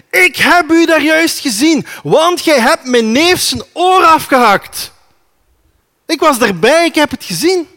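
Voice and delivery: high, loud accusing shout; angry accusation. Acted. Servant's relative accusing Peter, loud and high accusing shout. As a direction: throw the charge at him.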